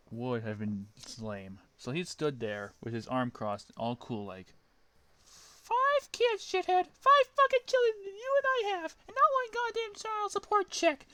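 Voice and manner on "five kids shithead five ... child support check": put-on very high, loud squeaky girl shriek; Amy voice. Amy screeching at Sonic as she swings her hammer. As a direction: screech it like a furious cartoon girl. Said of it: "Amy berating Sonic".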